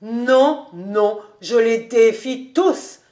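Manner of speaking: loud tipsy bravado; drunken defiant shout. A narrator's reading. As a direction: shout down the peacemaker. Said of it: "s'écriait Schultz".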